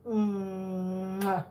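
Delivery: long soft "mmm-wah" smooch; drawn-out low kiss for a kitten; kissing noise. Real, not acted.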